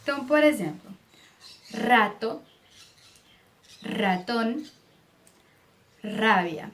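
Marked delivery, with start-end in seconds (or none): 1.7-6.7 rato, ratón, rabia with a rolled initial R; strong trilled R. Shown as a demonstration. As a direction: roll the R hard at the start of rato, ratón and rabia. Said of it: "som do R no começo das palavras". (R vibrante forte no começo de rato, ratón, rabia)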